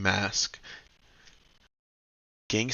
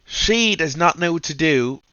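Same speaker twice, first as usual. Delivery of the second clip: excited; 8 semitones higher, 9 dB louder than usual. Real, not acted. Climax of story retelling.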